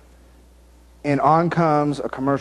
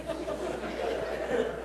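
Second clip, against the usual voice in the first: breathless silent laugh; preacher losing it mid-sermon; real laughter; 14 dB quieter than usual. Real, not acted. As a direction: crack up at your own joke.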